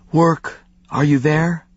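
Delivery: a thin, strained, slow plea; faint strained voice of a dying woman; weak and strained. A narrator's reading. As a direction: call out weakly, barely holding on. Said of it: "It was weak and strained".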